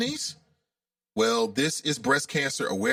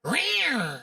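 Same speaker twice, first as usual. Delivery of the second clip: high, drawn-out feeble kitty roar; prancing little tiger; 6 semitones higher than usual. Acted. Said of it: "the little prancing tiger".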